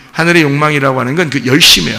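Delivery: normal talking voice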